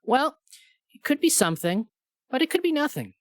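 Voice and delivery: high, lilting clueless doctor. Retold or imitated. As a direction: play a shrugging, vague doctor. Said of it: "And the doctor goes".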